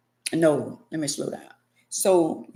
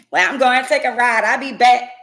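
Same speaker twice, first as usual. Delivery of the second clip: louder, curt announcement of leaving; big mad exit line; 5 semitones higher, 7 dB louder than usual. Acted. Angry partner leaving the house, curt loud exit line. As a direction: storm out with a threat.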